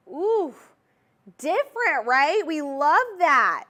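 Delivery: light up over the ring, slowing on the last words; excited gush. Real, not acted. Reacts to new ring.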